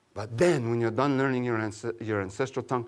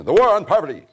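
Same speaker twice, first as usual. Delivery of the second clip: mock slogan; 9 semitones higher, 14 dB louder than usual. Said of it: "I'm saying in English".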